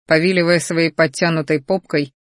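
plain reading voice

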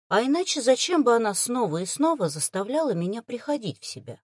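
plain reading voice

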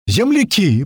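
high, joyful hail to countrymen; excited yell. A narrator's reading. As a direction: call out high to fellow countrymen. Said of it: "продолжал тот орать".

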